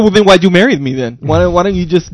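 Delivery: loud, indignant comeback; wife snapping back at a small complaint, loud and indignant; indignant retort. Retold or imitated. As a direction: throw the complaint back hard.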